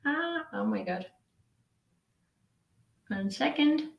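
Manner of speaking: ordinary talk, nothing marked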